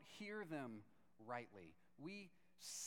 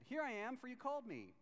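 higher-pitched answer to a call; young Samuel answering; 7 semitones higher than usual. Acted. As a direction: pipe up as the boy reporting in. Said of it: "he went to Eli and said".

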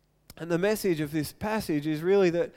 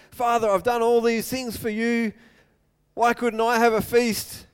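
louder, higher aggrieved outburst; resentful complaint; 6 semitones higher, 7 dB louder than usual. Acted. Hardworking son complaining to his father about the feast, aggrieved. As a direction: vent the grievance at full volume.

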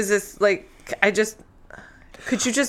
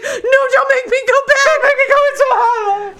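high loud wailing beg through laughter; mock begging; 5 dB louder than usual. Heroine begging not to go back, high laughing wail. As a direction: wail it like a hammed-up plea.

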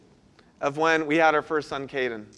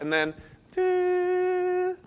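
a long, high, slow electronic tone; flatline beep; 12 semitones higher, 5 dB quieter, much slower than usual. Retold or imitated. The heart monitor flatlines in the delivery room, a long drawn-out beep.

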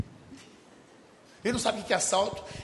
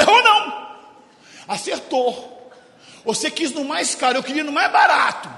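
much higher and louder, laughing triumph; triumphant loud retort; 7 semitones higher, 8 dB louder than usual. Retold or imitated. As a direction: rub it in, loud and gleeful. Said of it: "Eu falei". (réplica triunfante, alta e rindo)

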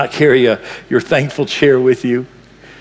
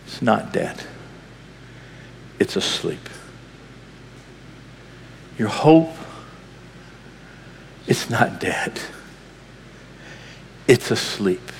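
hushed; 5 semitones lower, 11 dB quieter than usual. Real, not acted. Preacher softly assuring the congregation: hushed, low, gentle. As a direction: drop to a soft hush, much lower and softer. Preacher drops to intimate hush.

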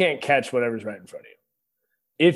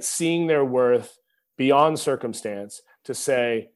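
ordinary talk, nothing marked

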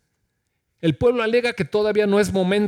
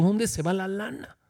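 softer, muttered wondering to himself; quiet mock lament; 6 dB quieter than usual. Pastor imitating his own private complaint, softer. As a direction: let it fall away, puzzled and soft. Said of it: "Yo a veces digo".

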